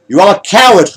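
high, loud accusation; arguing. A narrator's reading. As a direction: hurl the insult at the pilot. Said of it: "argued Captain Riley".

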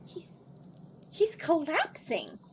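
staring in disbelief; stunned. A narrator's reading. Alienora gasps as the wizard collapses. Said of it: "in a stunned voice".

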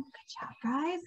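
softly whispered good job; whispered praise. Real, not acted. Teacher whispering praise to the children after the song, hushed.

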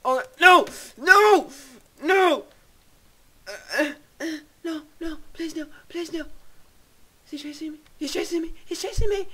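high, crying panic; anguished denial. Real, not acted. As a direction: plead while fleeing in terror. Being chased in game.

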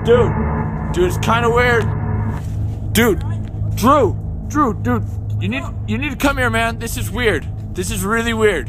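spooked and calling for backup; alarmed calling. Acted. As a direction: urgently summon your friend over. Uneasy, summoning friend while searching.

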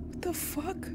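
high, very soft disbelief; shaken, barely voiced reaction; stunned hush. Real, not acted. Player recovering from a scare, stunned hush.

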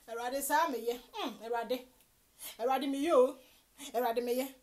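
ordinary talk

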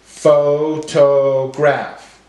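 pho... to... graph, very slow; broken into syllables. Shown as a demonstration. Teacher breaking up words for class, syllable by syllable. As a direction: give every syllable full weight, slowly. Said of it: "break up our words into syllables".

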